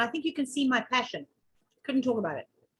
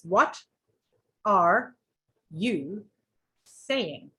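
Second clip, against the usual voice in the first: "What", "are", "you", "saying" each set apart by a pause; word by word; 2 semitones lower, much slower than usual. Shown as a demonstration. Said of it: "she wrote it down like this".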